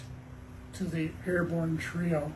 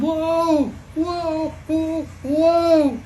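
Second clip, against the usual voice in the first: high, loud drawn-out whoas; swooping storybook scream; 9 semitones higher, 7 dB louder, much slower than usual. A narrator's reading. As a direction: scream like you are plunging downwards. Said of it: "They all screamed".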